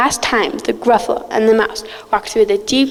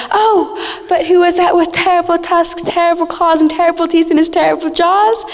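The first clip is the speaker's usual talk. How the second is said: rising alarm; 6 semitones higher than usual. Acted. Mouse sees monster.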